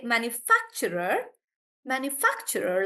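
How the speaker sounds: manufacturer with FAC punched; stress on FAC. Shown as a demonstration. Teacher modelling manufacturer for the class, fac stressed. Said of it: "so the stress is on fac".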